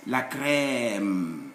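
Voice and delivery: "La crème" with a long drawn-out open e; gliding A sound. Shown as a demonstration. Teacher showing accent grave, vowel gliding. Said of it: "like a gliding A sound".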